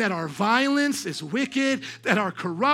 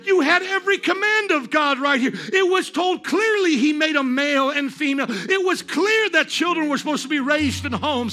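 high, loud, driving accusation; full-force preaching; 8 semitones higher, 6 dB louder than usual. Acted. Preacher voices the witnesses rebuking this generation, shouting it out. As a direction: hammer each point at the top of the voice.

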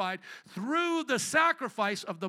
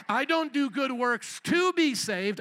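"to be saved" slow, high and loud; emphatic contrast; 4 semitones higher, 5 dB louder, slower than usual. Real, not acted. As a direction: punch and stretch "to" before "be saved". Preacher contrasts "to" with "because.

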